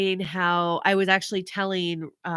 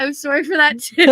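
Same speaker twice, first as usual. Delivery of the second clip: higher, louder, through laughter; laughing apology; 5 semitones higher, 6 dB louder than usual. Retold or imitated. Parent apologizing for the yell, laughing. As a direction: break up laughing while apologizing.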